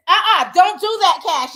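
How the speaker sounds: loud sharp uh-uh at the dog; scolding dog. Real, not acted. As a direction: warn the puppy off loudly. Scolding puppy biting her.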